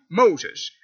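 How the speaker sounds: ordinary talk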